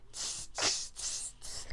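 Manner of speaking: soft voiceless tss noises; monkey chatter. A narrator's reading. Monkeys answering the peddler back, soft hissy chatter. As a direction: imitate monkeys with little hissed clicks.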